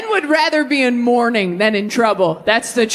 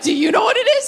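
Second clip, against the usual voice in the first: put-on nervous kid; 9 semitones higher, much faster than usual. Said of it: "I was like".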